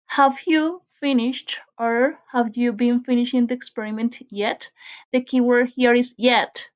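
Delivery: ordinary delivery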